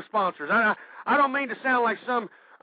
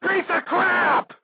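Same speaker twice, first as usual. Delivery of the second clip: boiling anger; 7 semitones higher than usual. Real, not acted. Host ranting against the media, furious yell. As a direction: spit the last words out in rage. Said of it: "my blood is boiling".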